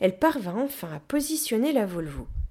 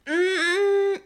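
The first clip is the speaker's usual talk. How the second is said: high, loud effort grunts; straining roar; 12 semitones higher, 6 dB louder than usual. A narrator's reading. Gorilla pushes the caravan, roaring with effort. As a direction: groan as if heaving a great weight. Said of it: "rugit la gorille sous l'effort".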